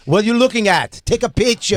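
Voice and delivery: put-on half-hearted African accent, confrontational; loosely imitated African accent, challenging; bad African accent. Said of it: "I can't do an African accent".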